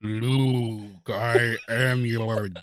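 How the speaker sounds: slow, heavy, laughing through it; Darth Vader voice. Retold or imitated. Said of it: "You're like".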